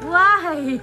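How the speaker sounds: normal talking voice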